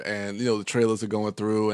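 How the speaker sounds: normal talking voice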